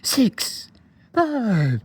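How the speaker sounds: giddy voice. Retold or imitated. Friend reading the middle numbers on the prank, giddy high squeak. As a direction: squeal the numbers like an excited kid. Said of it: "real, uh, giddy".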